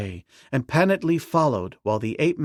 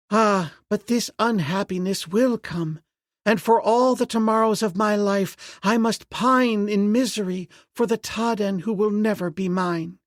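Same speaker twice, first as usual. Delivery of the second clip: put-on mournful young princess; 10 semitones higher than usual. A narrator's reading. Princess lamenting her lost love, high voiced.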